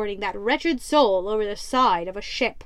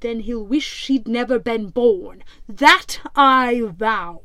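louder menacing pirate threat; 5 dB louder than usual. Acted. Captain threatens the terrified prisoner, menacing. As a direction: loom over the prisoner with menace.

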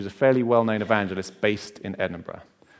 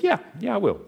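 eager agreement; 11 semitones higher than usual. Retold or imitated. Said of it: "And he said".

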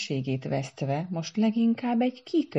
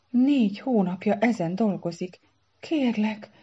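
mildly desperate, higher-pitched plea; despairing; 6 semitones higher, 3 dB louder than usual. A narrator's reading. Para pleading for his mother's gift, mildly despairing. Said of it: "kétségbeesve kislogott".

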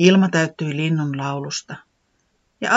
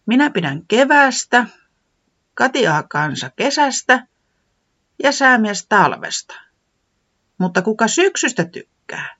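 high, louder musing voice; Tiputi asking; 8 semitones higher, 4 dB louder than usual. Acted. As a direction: list friends then wonder. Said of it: "Tiputi jatkoi".

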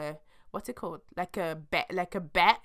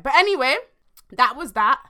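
emphatic wrap-up; 7 semitones higher, 8 dB louder than usual. Real, not acted. Wrapping up the story.

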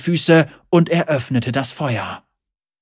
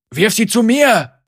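loud, high shout; thundering command; 9 semitones higher, 6 dB louder than usual. A narrator's reading. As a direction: bellow it across the room. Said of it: "donnerte Lucian".

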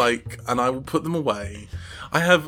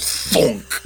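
imitated thonk; 3 dB louder than usual. Describing a bottle dropped in a bath, imitated thonk. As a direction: make the bottle bonk sound with your voice.

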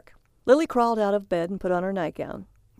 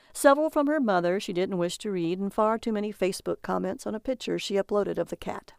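plain reading voice

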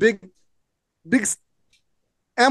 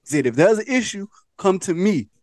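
low, measured imitation of calm advice; nice, calm; 4 semitones lower than usual. Man retelling how his friend addressed the kid, nice and calm. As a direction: talk the kid down calmly, pitched low. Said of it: "said it in a nice, calm way".

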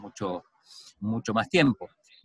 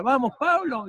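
retold crowd shouting; 13 semitones higher than usual. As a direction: imitate spectators yelling for a runner. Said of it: "empezaron a gritar".

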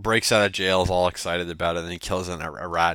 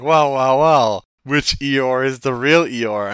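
loud, drawn-out mock challenge; put-on voice, raised and stretched; acted confrontation; 5 semitones higher, 9 dB louder, much slower than usual. Voices Pooh confronting two Eeyores.

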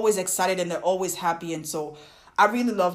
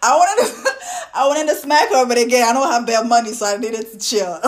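loud, high, laughing outburst; 3 semitones higher, 7 dB louder than usual. Real, not acted. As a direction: laugh through the exasperated confession. Laughing over urge to smack.

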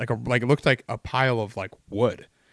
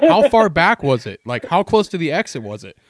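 louder and higher, half laughing, insisting; urgent loud repeat; 5 semitones higher, 7 dB louder than usual. Retold or imitated. To coworkers after slamming the brakes, loud urgent repeat. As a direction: demand it again, excited and pushy. What are you fucking doing?.

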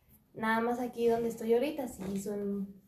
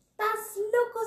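much higher, louder shocked yell; retold shout; 11 semitones higher, 5 dB louder, slower than usual. Woman recalling being choked by a friend, shouting in alarm.

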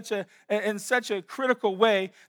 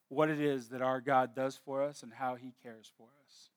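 soft reverent; 6 semitones lower, 6 dB quieter than usual. Real, not acted. Tender reflection on psalm.

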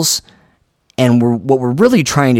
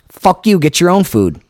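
mock rude dismissal; 7 semitones higher than usual. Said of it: "he's like".

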